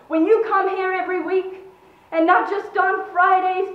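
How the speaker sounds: righteous, forceful dressing-down; dignified fury. A narrator's reading. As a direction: build an outraged accusation, hitting each item harder. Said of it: "unhinged with dignified fury".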